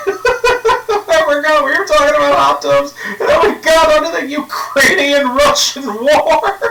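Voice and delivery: high-pitched laugh through words; words squeezed out mid-laugh; laughing hard. Real, not acted. Cracking up at the tangent.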